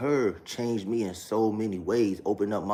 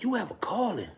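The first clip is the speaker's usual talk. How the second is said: much higher mock pastor voice; imitating father-in-law; 13 semitones higher than usual. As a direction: pitch way up to mimic him. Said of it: "This is how her father talked".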